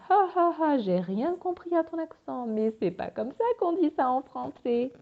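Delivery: mock laugh into high, jeering criticism; high-pitched ridicule, hammed up. Acting out someone mocking an accent.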